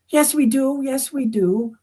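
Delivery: put-on high, sweet girly voice; teen girls asking. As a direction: ask politely like a star-struck teenager. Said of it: "the girls insist".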